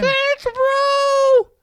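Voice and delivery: very high, loud whale wail; translated whale. Acted. Whale thanking his rescuer through translation, loud high wail. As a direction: sing out the words as a long whale call. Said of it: "But with our translation".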